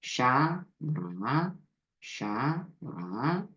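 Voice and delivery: "샤" and "뭐라" said apart with a clear pause between; separated syllables. Shown as a demonstration. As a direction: split it after a long "샤", then say "뭐라". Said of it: "여기까지 구분해서 발음하세요".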